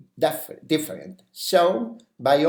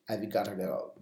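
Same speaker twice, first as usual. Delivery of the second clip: softer "I've gotta go", falling on "gotta"; model intonation; 4 semitones lower, 7 dB quieter than usual. Shown as a demonstration. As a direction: show the tune quietly. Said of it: "the intonation".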